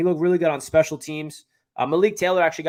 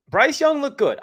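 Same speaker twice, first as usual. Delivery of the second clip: excited; 10 semitones higher, 6 dB louder than usual. Real, not acted. Excited praise of rookie.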